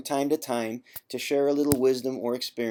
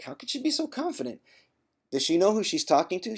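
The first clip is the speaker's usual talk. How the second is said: incredulous self; 7 semitones higher, faster than usual. Retold or imitated. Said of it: "And I was like".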